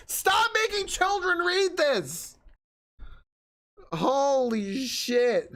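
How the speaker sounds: very high, drawn-out wail of protest; exasperated outcry. Real, not acted. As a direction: cry out slowly in comic despair. Outburst against the assigned book.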